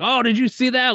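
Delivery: high, bright gloating shout-out; excited brag. Retold or imitated. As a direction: crow about the lucky throw. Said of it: "Like that".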